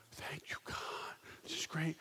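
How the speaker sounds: relieved unvoiced whisper; sneaking past his sleeping dad, whispering thanks to God.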